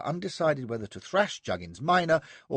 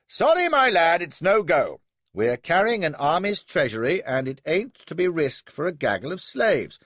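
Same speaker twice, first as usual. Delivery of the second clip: loud, higher, overruling; officer overriding the young captain; firm bellow; 7 semitones higher, 6 dB louder than usual. A narrator's reading. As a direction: shut the lad down with authority. Flashman overrides him firmly.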